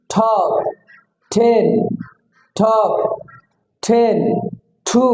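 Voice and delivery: "ঠক", "ঠেন", "ঠু" with a puffed t; aspirated T. Shown as a demonstration. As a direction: burst air on the t of "ঠক", "ঠেন", "ঠু". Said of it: "ঠয় দীর্ঘিকারে".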